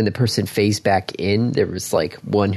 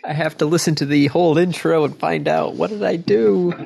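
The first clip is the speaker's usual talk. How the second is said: mock panic; 7 semitones higher than usual. Playing along, mock panic.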